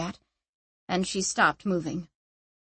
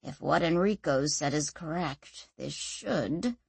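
quiet low mutter; 5 semitones lower, 7 dB quieter than usual. Acted. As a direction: keep muttering under your breath. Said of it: "he muttered".

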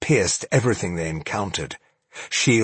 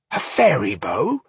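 confused exclamation; 4 semitones higher than usual. Acted. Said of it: "she exclaimed, confused".

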